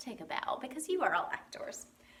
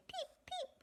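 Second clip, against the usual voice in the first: high, soft little peeps; tiny high-pitched chick imitation; chick's peep; 10 dB quieter than usual. Reading the cow book to children, high chick peeps. Animal sound from the storybook.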